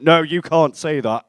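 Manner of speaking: loud, slow, emphatic telling-off; imitated scolding. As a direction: put your foot down loudly, word by word. Girl scolds him more firmly.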